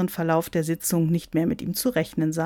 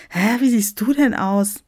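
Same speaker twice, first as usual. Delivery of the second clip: higher, louder bewildered question; startled blurt; 4 semitones higher, 5 dB louder than usual. Acted. Niklas sees the bearded bishop, startled. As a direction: blurt it out in astonishment. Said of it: "entfuhr es Niklas".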